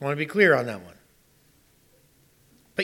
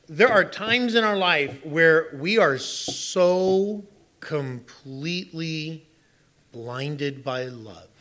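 very slow, fading emphasis; much slower than usual. Real, not acted. Preacher drawing out key phrase.

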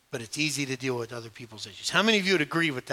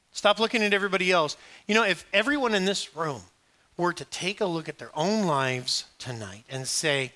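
ordinary talk, nothing marked

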